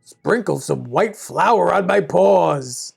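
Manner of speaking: slow, put-on wolf voice; demanding wolf. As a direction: order it as the wolf, drawing it out. Wolf orders the miller.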